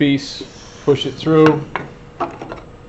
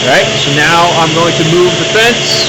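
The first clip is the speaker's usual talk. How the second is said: raised voice over machine noise; shouting over table saw; 4 semitones higher, 5 dB louder than usual. Real, not acted. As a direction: project loudly over the saw. Cutting with saw running.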